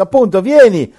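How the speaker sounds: loud, high, hammed-up preacher's invitation; mock a street evangelist bullying someone into church; pushy evangelist. Parodies a pushy preacher inviting a stranger, loud and high.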